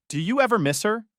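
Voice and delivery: a hint of a curious teenage girl asking gently; daughter's question. Daughter asking while making dinner.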